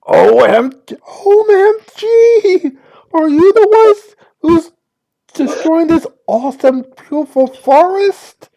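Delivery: put-on high, bubbly Valley Girl. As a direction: gush like a bubbly sorority girl. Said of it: "my Valley Girl voice".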